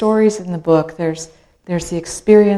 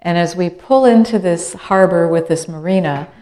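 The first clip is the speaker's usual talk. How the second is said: ordinary delivery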